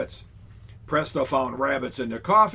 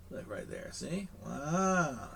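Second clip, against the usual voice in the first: hushed aside; 9 dB quieter, slower than usual. Real, not acted. Pointing quietly at picture.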